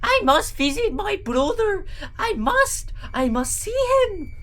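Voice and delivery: high, panicky cartoon accent; hammed-up panic in a squeaky character voice; panicked Gru. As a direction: plead in a high, put-on accent. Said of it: "He sounded kind of panicked".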